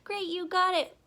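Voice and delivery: high-pitched acted praise; high, encouraging classroom cheer; teacher's praise. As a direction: congratulate the class brightly. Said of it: "And I would go".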